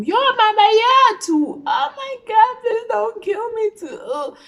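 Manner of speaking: high, loud wailing plea; imitated screaming. Friends overheard her begging her mom, imitated screaming. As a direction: scream out hammed-up pleas for mercy. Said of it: "They heard me screaming from".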